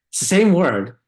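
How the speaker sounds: ordinary talk, nothing marked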